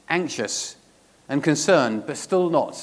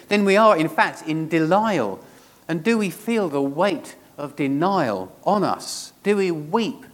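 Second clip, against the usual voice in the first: raised-pitch, insistent sermon delivery; impassioned preaching; 4 semitones higher, 3 dB louder than usual. Real, not acted. Preacher challenges the congregation over their own denial, impassioned preaching. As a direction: press each question home to the congregation.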